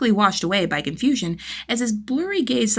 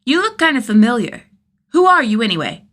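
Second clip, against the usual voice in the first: put-on higher, louder child voice asking; a bright curious boy, raised and loud; curious kid; 7 semitones higher, 9 dB louder than usual.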